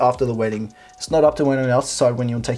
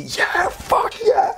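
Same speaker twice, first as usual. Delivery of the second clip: drawn-out falsetto squeal, very high; going crazy; much slower than usual. Acted. Acting out her wild reaction to the verdict, going crazy. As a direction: squeal it slowly in mock celebration. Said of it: "she's just going crazy".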